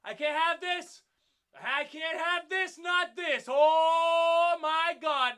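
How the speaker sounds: loud, high, frantic pleading; panicked shouting. Retold or imitated. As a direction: scream it as the car is about to hit her. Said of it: "and I was like".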